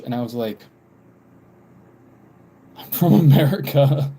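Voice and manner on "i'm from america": retold protest broken by laughter; louder, higher, laughing as you protest; laughing retort. Retells amused baffled reply to guest.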